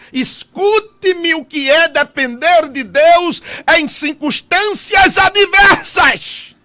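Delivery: loud, high preaching with shouts; fiery preaching. Acted. Pastor preaching loudly to the congregation. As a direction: preach it out to the church with full force.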